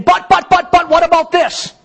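high, mock-whiny protest; objecting listener. Pastor mimicking a doubter in the congregation, high mock protest. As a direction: squeak out the objection like a flustered listener. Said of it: "and you're saying".

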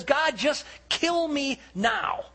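high, slow, pleading despair; acted desperate plea; anguished cry to God. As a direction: cry it out, stretching each word. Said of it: "he's not being phony here".

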